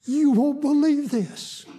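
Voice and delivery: very high, drawn-out wonder; retold amazement. Patient shares news with visiting preacher, retold amazement. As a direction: stretch it out high, imitating astonished news.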